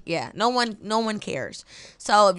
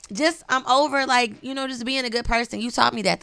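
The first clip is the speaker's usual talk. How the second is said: usual speaking voice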